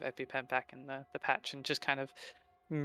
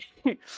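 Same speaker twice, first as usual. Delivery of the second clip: laughing; 7 dB louder than usual. Real, not acted. Player laughs.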